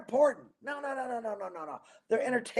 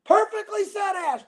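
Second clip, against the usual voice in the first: shouted high praise; excited praise; 9 semitones higher than usual. Real, not acted. Praising viewer's quote.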